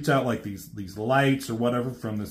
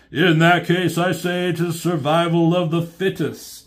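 Constructicon voice; 6 semitones higher, 4 dB louder than usual. Retold or imitated. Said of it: "one of the Constructicons chimes in".

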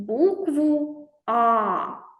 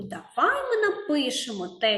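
ordinary talk, nothing marked

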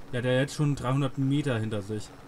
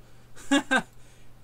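short laugh; 12 semitones higher, 4 dB louder than usual. Real, not acted. Laughs at own mistake.

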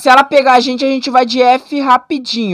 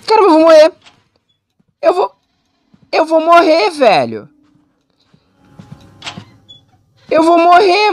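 scared; 4 semitones higher than usual. Real, not acted. Scared in horror game.

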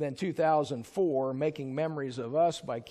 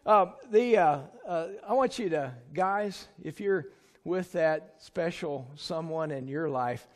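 normal talking voice

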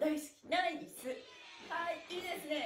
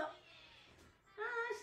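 relieved sigh; 10 semitones higher, 7 dB quieter than usual. Real, not acted. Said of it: "relief sigh".

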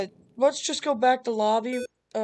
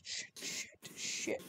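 whispered swearing; 19 dB quieter, much slower than usual. Real, not acted. Hiding from monster.

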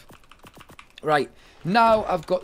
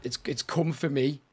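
ordinary talk, nothing marked

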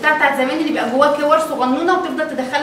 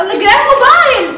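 high, loud surprised cry; 9 semitones higher, 6 dB louder than usual. Real, not acted. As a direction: react big to the find. Mother surprised at phone case. (مفاجأة بصوت عالي)

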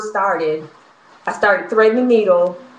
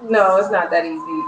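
normal talking voice

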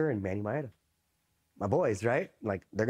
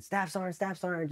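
mock panicked yelling; 8 semitones higher, 3 dB quieter than usual. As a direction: retell a call for help without shouting. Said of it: "what are they yelling".

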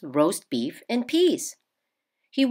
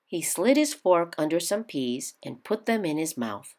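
usual speaking voice

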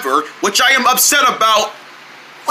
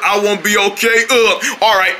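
ordinary talk

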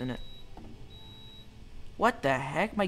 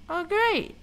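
sarcastic; 4 dB louder than usual. Real, not acted. Sarcastic at robot's search.

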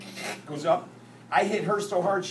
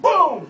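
loud, raised "boom"; impact imitation; 7 semitones higher, 9 dB louder than usual. Telling of the Greyhound crash, sudden loud boom. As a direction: punch out the collision noise.

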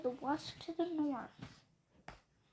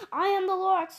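loud proud announcement, a touch higher; booming Lorax; 3 semitones higher, 8 dB louder, faster than usual. Acted. Lorax introduces himself to the Once-ler, loud and proud. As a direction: boom out your name like a self-appointed guardian.

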